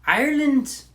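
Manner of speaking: high, loud leprechaun lilt; exaggerated Irish accent. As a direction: go cartoon Irish, quick and high. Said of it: "you can just exaggerate it and pretend".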